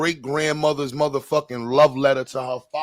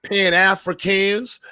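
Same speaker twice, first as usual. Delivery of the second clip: loud, raised-pitch roll call to a congregation; mock church preaching; 5 semitones higher, 5 dB louder than usual. Friend playfully preaches to the whole room, loud mock sermon. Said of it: "I'm on my baby Jesus shit".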